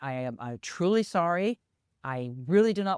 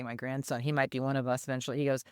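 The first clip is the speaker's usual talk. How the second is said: usual speaking voice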